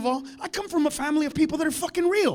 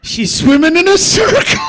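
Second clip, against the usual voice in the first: high, loud mock-gleeful holler; shouting shark; 5 semitones higher, 12 dB louder than usual. Shark mocking the returning surfer, high gleeful shout. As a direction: yell the punchline to a fellow shark.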